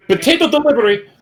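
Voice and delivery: high strange bird voice; high squeaky voice. Acted. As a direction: squeak it like a parrot. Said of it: "so many different voices".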